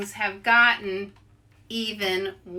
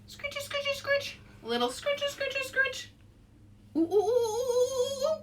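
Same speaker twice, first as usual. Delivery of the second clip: high, fast playful monkey chatter; leading kids in silly monkey noises, very high; monkey sounds. Shown as a demonstration. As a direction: scratch and hoot like a monkey. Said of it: "scratch like monkeys".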